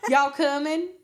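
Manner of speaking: put-on a cute Southern toddler calling to dinner; Southern accent. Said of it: "the Southern accent".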